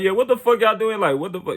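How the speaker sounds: angry challenge to the police; talking back angrily. Retold or imitated. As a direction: mouth off at the cops as they drag him out. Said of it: "I'm still talking shit".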